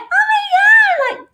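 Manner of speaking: put-on very high, drawn-out little-girl squeal; mock yourself as a squeaky little girl. Host mocking her recorded voice, high drawn-out little girl. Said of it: "I sound like a little girl".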